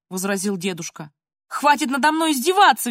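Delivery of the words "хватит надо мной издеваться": high, louder cry of protest; narrated girl's squeal of outrage; shrieking. A narrator's reading. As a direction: shriek it, slightly held back. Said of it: "визжала Полина".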